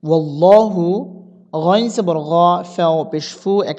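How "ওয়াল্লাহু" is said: ওয়াল্লাহু with a heavy, full "law"; thick Allah sound. Shown as a demonstration. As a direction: read ওয়াল্লাহু with a fattened middle. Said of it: "তাহলে এভাবে পড়তে হবে".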